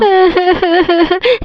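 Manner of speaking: high staccato fake sobs; mock sobbing. Scolded wife crying over a saree, acted sobs. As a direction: sob in theatrical bursts. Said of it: "mummy कितना ज़्यादा रो रही है".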